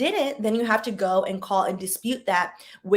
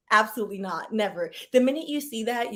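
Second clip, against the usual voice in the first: ordinary delivery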